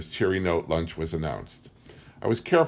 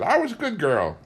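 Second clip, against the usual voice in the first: put-on old tribeswoman; 8 semitones higher than usual. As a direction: speak as the last of her tribe, high and old. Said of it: "She said".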